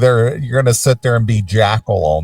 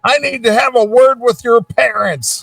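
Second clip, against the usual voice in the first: put-on old man voice; 11 semitones higher than usual. Continuing old man impression.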